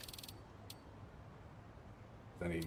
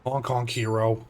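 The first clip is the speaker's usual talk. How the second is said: excited; 4 semitones higher, 7 dB louder than usual. Acted. Playful announcing of game item.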